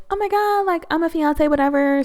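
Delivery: high-pitched giddy mock squeal; excited. Newly engaged Stacy gushing to the girls, high excited squeal. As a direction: gush like a newly engaged girl. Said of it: "She's, like, excited".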